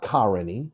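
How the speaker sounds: "Karini" as KAR-uh-nee; first-syllable stress in "Karini", middle reduced. Shown as a demonstration. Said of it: "It's not Karini".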